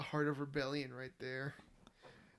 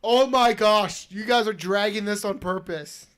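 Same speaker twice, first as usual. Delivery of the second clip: exasperated; 4 semitones higher, 9 dB louder, slower than usual. Retold or imitated. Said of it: "and I was like".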